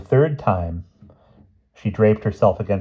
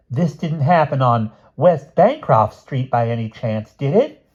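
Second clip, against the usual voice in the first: higher, louder, slow careful question; small gentleman, inquiring; 6 semitones higher, 5 dB louder, slower than usual. A narrator's reading. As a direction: ask it slowly, pitch lifting high at the end.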